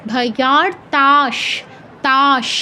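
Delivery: ভয়ার্তাশ slow, ending on a held sh; palatal sh demo. Shown as a demonstration. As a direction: stretch ভয়ার্তাশ into a long final sh. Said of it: "তালব্য সর উচ্চারণটা হচ্ছে বিসর্গের জায়গায়". (ভয়ার্তাশ ধীরে, শেষে তালব্য শ)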